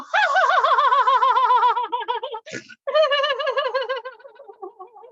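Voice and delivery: high, loud Santa-style ho-ho laugh; long belly laugh. Shown as a demonstration. Teacher leads class in Santa-style belly laughter. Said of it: "So we're gonna go".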